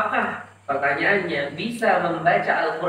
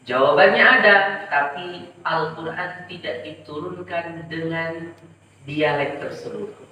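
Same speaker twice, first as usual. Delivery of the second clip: ordinary delivery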